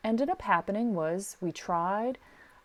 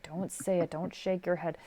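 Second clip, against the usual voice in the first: whisper. Shown as a demonstration. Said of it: "Yeah, like".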